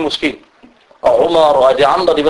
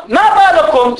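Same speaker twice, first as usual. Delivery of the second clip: indignant call; 11 semitones higher than usual. Retold or imitated. Man scolds people in prayer.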